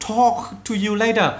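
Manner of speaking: loud, high, slow model line; friendly goodbye demo. Shown as a demonstration. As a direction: wrap up the chat kindly. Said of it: "như". (chào tạm biệt mẫu, chậm và to)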